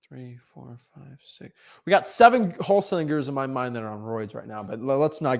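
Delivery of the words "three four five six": barely audible, near-whispered count; counting to oneself. Real, not acted. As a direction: count under your breath. Counting to himself the gurus.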